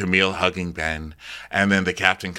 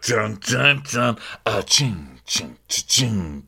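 spoken slow-mo beat, dun and ching; imitated walk music; slower than usual. Mimicking the ladies' slow-mo walk, imitated music.

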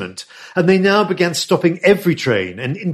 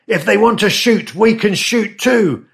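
shouted; 7 semitones higher than usual. A narrator's reading. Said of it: "an American voice shouted".

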